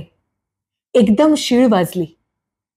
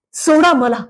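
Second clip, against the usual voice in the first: "सोडा मला" pleaded even higher, more desperate, falling; last plea, "सोडା मला" pushed higher; 7 semitones higher than usual. A narrator's reading. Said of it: "दिनवाणेपणाने ... विनवित होते".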